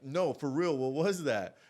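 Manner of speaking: irritated, forceful questioning; getting pissed. Retold or imitated. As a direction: act out losing patience with a friend. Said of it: "And I'm getting pissed".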